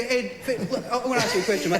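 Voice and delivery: ordinary talk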